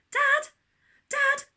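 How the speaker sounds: put-on high, loud little girl calling; child calling. A narrator's reading. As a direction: call for Dad urgently, like a child. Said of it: "said Daisy".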